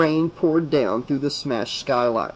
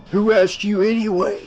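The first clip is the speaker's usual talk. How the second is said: a louder, higher snarled put-down; 6 semitones higher, 3 dB louder than usual. A narrator's reading. Mario snarling at Keith for criticising the sweater. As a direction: snarl it at him. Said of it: "Mario snarled at him".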